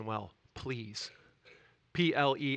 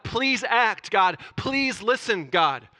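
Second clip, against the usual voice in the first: pleading, higher and louder; crying out to God; 7 semitones higher, 7 dB louder, much slower than usual. Retold or imitated. Daniel crying out to God, voiced as a plea. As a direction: beg God to act. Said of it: "he's crying out to God".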